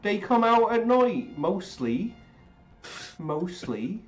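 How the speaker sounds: put-on eerie little girl voice. Movie quote impression.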